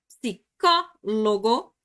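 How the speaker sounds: psicólogo as psi-CÓ-lo-go with CÓ punched; split into syllables. Shown as a demonstration. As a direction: break psicólogo apart, stress the CÓ. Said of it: "Última, penúltima".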